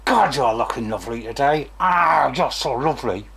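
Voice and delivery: lovely words in a contradicting tone; put-on tone that belies the compliment; mismatched intonation. Shown as a demonstration. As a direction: say the compliment against its meaning, in a put-on voice. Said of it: "the words and the intonation".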